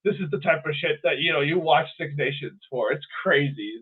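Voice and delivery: usual speaking voice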